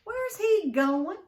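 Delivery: high, loud, slow cry; a girl crying out as he walks away. A narrator's reading. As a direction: blurt it out, upset and loud. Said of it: "I cried".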